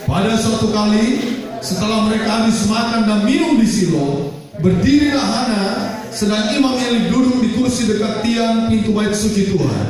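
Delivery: high, emphatic pulpit reading; scripture reading. Acted. Preacher reads the verse to the congregation, high and emphatic. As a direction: read out the verse, stretching the key words.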